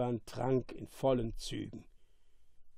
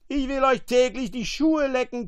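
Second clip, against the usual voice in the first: high, raised monster voice; screeching; 10 semitones higher, 5 dB louder than usual. A narrator's reading. Monster grovelling for the bottle, screeching. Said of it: "kreischte Caliban".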